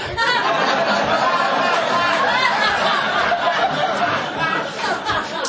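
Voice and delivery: a long, high peal of real laughter. Real, not acted. Ex-cop laughs at his own hiring story, a long real laugh. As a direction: laugh hard at the irony. Laughing about getting hired.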